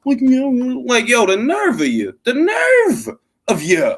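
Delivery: hammed-up nerd outrage; nerdy voice impression. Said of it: "talking like this".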